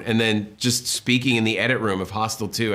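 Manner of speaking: normal talking voice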